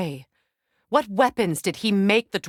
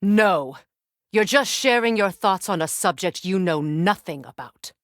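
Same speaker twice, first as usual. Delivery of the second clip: usual speaking voice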